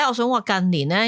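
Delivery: ordinary talk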